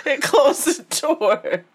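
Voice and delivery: high, slow words through laughs; helplessly amused; real laughter. Real, not acted. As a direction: barely get it out for laughing. Cracking up at child's exit.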